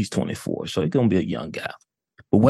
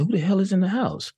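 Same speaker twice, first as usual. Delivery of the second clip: higher-pitched startled question; retold alarm; 11 semitones higher than usual. Brother recalling hearing noises upstairs, alarmed and high-pitched. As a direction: act out hearing an intruder, voice pitched up.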